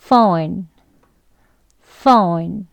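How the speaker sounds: "phone" with a clear F, ph said as F, rising; teacher modelling "phone" with an F; F sound demo. Shown as a demonstration. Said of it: "같이 해볼게요".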